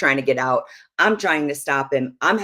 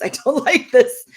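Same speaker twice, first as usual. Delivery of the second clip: breaking into a laugh on "I don't like this", higher and louder; 9 semitones higher, 6 dB louder than usual. Retold or imitated. Wife laughing at her own repeated complaint to husband, laughing. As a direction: laugh through the third repeat.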